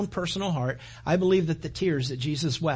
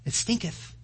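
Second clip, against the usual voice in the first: relishing the word; 4 semitones higher, 4 dB quieter than usual. Shown as a demonstration. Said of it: "I like the way it says".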